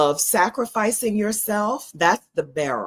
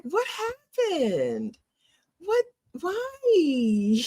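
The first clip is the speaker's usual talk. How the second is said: much higher, slow, near-whining why; exasperated; 12 semitones higher, much slower than usual. Real, not acted. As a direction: plead in disbelief, pitched way up. Exasperated at men under forty.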